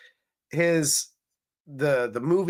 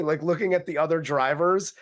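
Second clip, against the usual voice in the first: ordinary delivery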